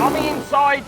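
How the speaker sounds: excited. Real, not acted. Overtake called live.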